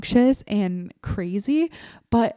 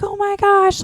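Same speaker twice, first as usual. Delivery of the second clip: mock alarm, pitch leaping up; exaggerated passenger panic; crazy overreaction; 11 semitones higher, 4 dB louder than usual. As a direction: imitate a freaking-out parent, high-pitched. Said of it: "just like, crazy".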